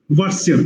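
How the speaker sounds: "What's your" as one short "wotsuh"; reduced "your" glued to "What's"; squeezed into one chunk. Shown as a demonstration. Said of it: "we can squeeze".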